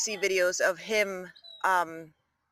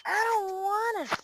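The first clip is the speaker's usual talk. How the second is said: put-on high whiny child voice; whiny kid voice for the pet; 11 semitones higher, slower than usual. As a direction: whine like a stubborn little kid. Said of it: "She's like".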